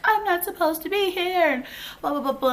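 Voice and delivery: high, whiny imitation of tearful complaining; mock crying. Mimicking a drunk cellmate crying and talking, mock crying. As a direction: wail it mockingly, sliding into dismissive blahs. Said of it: "she was just in there crying and talking".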